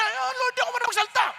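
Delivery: high-pitched acted excuse to God; hammed-up reluctant prophet; protesting Moses. Acting out Moses making excuses.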